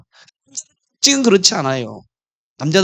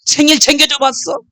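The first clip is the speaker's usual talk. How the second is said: higher-pitched, acted demanding questions; angry wife; 8 semitones higher than usual. Wife grilling husband about forgotten birthdays, mock-angry. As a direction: confront him like an aggrieved wife. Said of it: "막 화가 나가지고".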